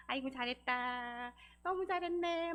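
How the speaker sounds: slow, drawn-out sing-song praise; self-praise. Shown as a demonstration. Counselor showing how to praise yourself, fond sing-song. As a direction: coo approval at yourself, stretching the endings. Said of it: "본인을 칭찬하는 것도 하세요".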